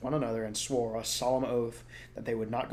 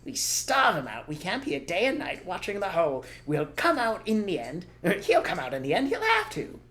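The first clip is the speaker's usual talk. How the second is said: crafty farmer; 7 semitones higher, 3 dB louder than usual. Acted. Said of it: "he said".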